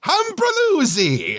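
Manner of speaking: high, drawn-out roar of nonsense; mock roar. A narrator's reading. Meg answers the silly game, high mock roar. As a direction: roar it up high and slow, playfully. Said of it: "And Meg roars".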